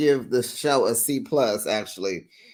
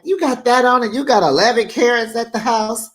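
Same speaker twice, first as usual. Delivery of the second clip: higher and louder incredulous jab; incredulous retort; 8 semitones higher, 6 dB louder than usual. Retold or imitated. Marcel teasing Tisha about her eleven carats, a high incredulous jab. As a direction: call her out in mock disbelief.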